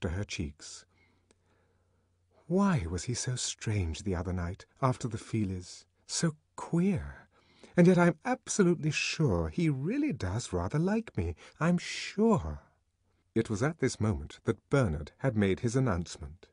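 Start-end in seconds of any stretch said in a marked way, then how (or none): 6.1-12.6 Lenina musing. A narrator's reading.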